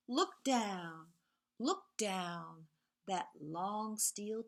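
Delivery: lively storybook reading with whispered refrains; reading to children. A narrator's reading. Teacher reads Two Little Trains to the class, sing-song storytelling. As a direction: read it like a bedtime picture book, rhythmic and playful.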